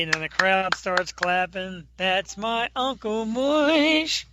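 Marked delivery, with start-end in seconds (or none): 2.0-4.2 sitcom catchphrase. Pretend.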